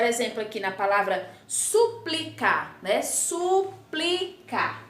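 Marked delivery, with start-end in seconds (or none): 1.5-2.8 SUPLICAR sounded out as "su-pli-cá"; careful SUPLICAR with the PL kept together; syllable demo. Shown as a demonstration. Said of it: "Então olha só". 3.0-4.8 SUPLICAR very slow and higher, "su... pli... cá"; high, stretched teacher's model of SUPLICAR; syllable demo. Shown as a demonstration. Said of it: "Então olha só".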